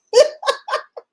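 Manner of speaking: high, rising laugh. Real, not acted. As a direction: laugh out loud at your own story. Laughs at her own anecdote.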